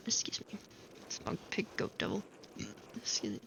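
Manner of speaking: laughing whispered aside; whispering. Real, not acted. Laughing aside at devil drawing.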